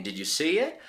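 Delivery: normal talking voice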